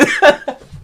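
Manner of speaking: short burst of very high, loud laughter. Real, not acted. Host still amused by snake lizards, high laugh. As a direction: crack up mid-sentence.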